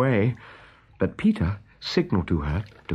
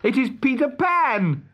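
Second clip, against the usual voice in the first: high, slow, proud announcement; Peter's own voice. A narrator's reading. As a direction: reveal yourself as the boy hero. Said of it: "replied Peter in his own voice".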